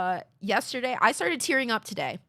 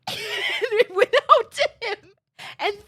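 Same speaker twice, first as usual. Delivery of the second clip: high, loud words through laughter; laughing; 9 semitones higher, 5 dB louder than usual. Real, not acted. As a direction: burst out laughing mid-sentence. Laughing while retelling tearful thanks.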